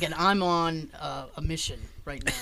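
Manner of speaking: low, softening secret-agent confidence; confiding Roger Moore. Retold or imitated. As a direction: let them in on a secret, voice dropped. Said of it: "he kinda leans in and he goes".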